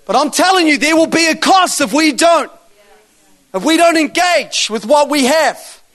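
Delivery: impassioned preaching. Real, not acted. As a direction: drive it home to the congregation. Preaches with force to congregation.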